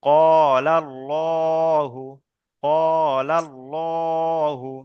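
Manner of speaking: "কলাল্লাহু" chanted very slowly, long qo and thick llo; demonstration recitation. Shown as a demonstration. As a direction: stretch "কলাল্লাহু" with held vowels and a heavy L. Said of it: "বানান এবং উচ্চারণ করে নিলাম". ("কলাল্লাহু" টেনে টেনে, পুর ল দিয়ে তিলাওয়াত)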